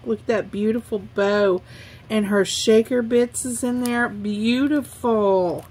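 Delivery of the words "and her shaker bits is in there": ordinary talk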